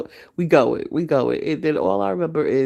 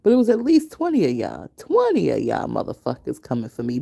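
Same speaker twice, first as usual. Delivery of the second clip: normal talking voice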